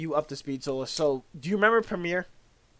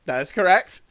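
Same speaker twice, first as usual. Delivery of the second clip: firm loud reply; 3 semitones higher, 8 dB louder than usual. Retold or imitated. Retold reply in quarrel.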